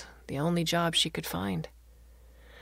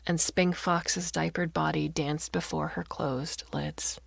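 ordinary delivery, nothing marked

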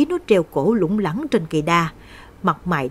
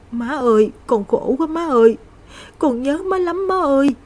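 high, wailing lament rising to a cry; crying to mother; 8 semitones higher than usual. Acted. Girl alone in the kitchen crying for her dead mother, high wail. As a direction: call out to a dead mother through tears. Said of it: "gục đầu xuống hai đầu gối khóc nức nở".

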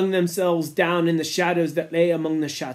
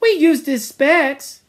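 Simon's voice; 9 semitones higher, 4 dB louder, slower than usual. Acted. Simon defending Piggy.

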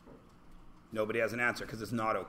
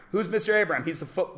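normal talking voice

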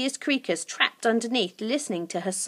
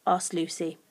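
ordinary delivery

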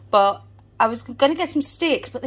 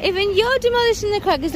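playful teasing; 11 semitones higher than usual. Real, not acted. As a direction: tease the little one in a high, playful voice. Teasing toddler in high playful voice.

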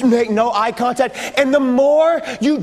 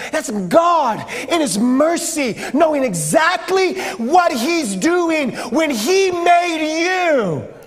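slow, higher, drawn-out emphasis; impassioned preaching; 5 semitones higher, slower than usual. Real, not acted. Preacher assures youth God made them on purpose, impassioned. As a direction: build deliberately to a ringing finish.

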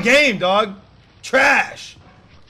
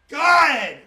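normal talking voice

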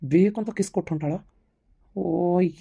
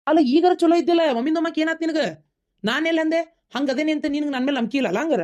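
high-pitched defensive pleading; exasperated protest; 8 semitones higher than usual. Acted. Man defending himself to jealous partner, exasperated. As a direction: protest your innocence, voice pitched right up.

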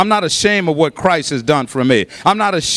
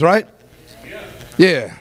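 ordinary talk

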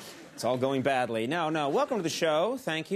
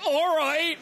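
high, loud cheer of a lone believer; hammed-up high-pitched enthusiasm; mock enthusiastic fan; 12 semitones higher, 6 dB louder than usual. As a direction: whoop it out like the one keen audience member. Mimics the lone enthusiastic audience member.

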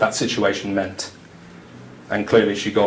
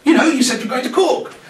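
high, louder jokey voice; high playful ribbing; teasing co-worker; 4 dB louder than usual. Retold or imitated. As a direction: tease him about his trip. Mimicking co-worker June.

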